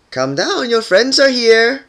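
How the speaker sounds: high, slow, loud call. A narrator's reading. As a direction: call it up the stairs. Calling up from downstairs.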